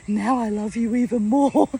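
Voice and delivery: loud, playful teasing; teasing wind-up. Retold or imitated. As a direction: wind her up with mock love. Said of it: "to wind me up a little bit".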